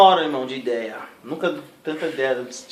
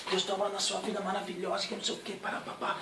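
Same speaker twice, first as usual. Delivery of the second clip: gentle prophecy; 4 semitones higher, 11 dB quieter than usual. Retold or imitated.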